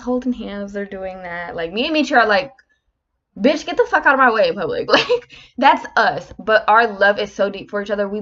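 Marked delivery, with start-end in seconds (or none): 3.4-4.9 louder, higher playful shove-off; mock-annoyed outburst; mock rough banter. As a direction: playfully tell your partner to move it. Acting out couple's rough banter in public.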